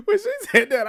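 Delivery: breaking up with laughter mid-line; laughing. Real, not acted. Recapping the insult, cracking up high and loud. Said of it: "I was dying laughing".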